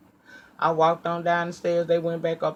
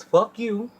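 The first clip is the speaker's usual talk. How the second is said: higher pitched, pointed curse; heated curse; 8 semitones higher, 4 dB louder than usual. Retold or imitated. Woman cursing out the church leaders, heated. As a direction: spit it at them. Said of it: "Yes, bitch, I'm heated now".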